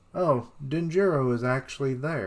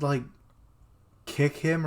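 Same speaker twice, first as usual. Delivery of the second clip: normal talking voice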